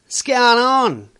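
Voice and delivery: rough bloke Australian; yobbo slang. Shown as a demonstration.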